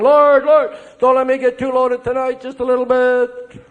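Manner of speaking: mock pleading prayer. Priest bargaining with God over drinking, mock pleading. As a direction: plead with the Lord, tongue in cheek. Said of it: "I'm saying".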